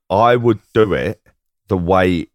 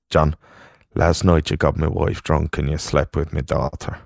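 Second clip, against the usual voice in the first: put-on Irish dad accent; 3 semitones lower, 3 dB quieter than usual. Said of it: "I'm gonna do the accent".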